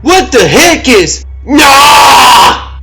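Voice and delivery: screaming high and loud in panic; terrified scream. Acted. Lab tech screaming in terror as the creature takes Jerry. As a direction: shriek in horror as the monster grabs him.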